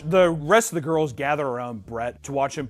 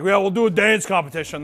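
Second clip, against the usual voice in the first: loud, higher smashed rocker; imitated drunk; 7 semitones higher, 8 dB louder than usual. Host mimics the smashed star proposing a contest, hammed-up drunk. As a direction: announce it with mock drunken gusto. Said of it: "while he's smashed".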